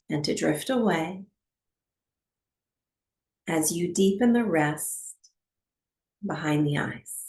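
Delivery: calm, unhurried meditation guide with long pauses; guided meditation. Acted. Teacher settling a class into breathwork, calm guided meditation. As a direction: ease them into stillness, phrase by phrase.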